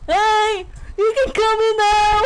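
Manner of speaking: calling out. Acted. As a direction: shout to someone waiting outside. Character calls someone in.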